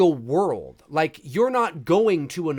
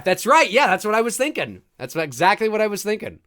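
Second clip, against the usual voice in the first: usual speaking voice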